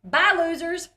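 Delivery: put-on cheeky kid's parting shot; sassy farewell. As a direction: toss it over your shoulder like a cheeky kid. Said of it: "walks out and says".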